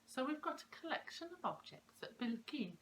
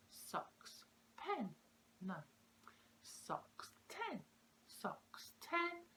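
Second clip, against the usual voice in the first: "socks" whispered, then "pen" or "ten" sung out much higher; rhyme demo; 4 semitones higher, much slower than usual. Shown as a demonstration. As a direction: set each word apart so children hear whether they rhyme. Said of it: "emphasising the words".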